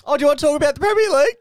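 high, loud, fast gushing in a put-on voice; mock excitement. Co-host mocking the Premier League segment with gushing excitement. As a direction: ham up fake delight at the topic. Sarcastic mock enthusiasm about topic.

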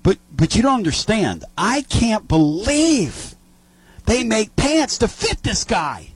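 mock Butch marveling at the pants; imitating Butch. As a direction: ham up the disbelief, drawn out. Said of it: "and said".

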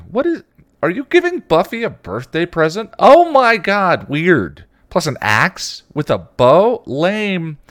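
put-on high, loud, scornful teen girl; mock like an incredulous kid sister; haughty teenage snark. A narrator's reading. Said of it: "haughty teenage snarkiness".

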